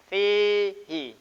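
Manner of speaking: Fihi with the long final vowel drawn out; teacher modelling fihi; recited Arabic. Shown as a demonstration. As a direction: hold the ee of fihi. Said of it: "Gitu ya caranya".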